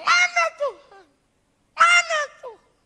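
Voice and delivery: very high, loud scream to God. Retold or imitated. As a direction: scream it out in desperation. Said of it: "ketika saya teriak".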